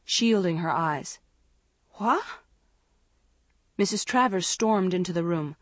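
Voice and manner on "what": high, rising question; a short puzzled query; groggy query. A narrator's reading. Woken sister to intruder, puzzled question. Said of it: "Andrea groaned and turned over slowly".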